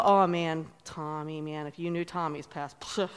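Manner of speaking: low, very soft imitation of gossip; hushed gossip. Imitating people talking about Tommy's past, hushed. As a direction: drop it right down, confiding.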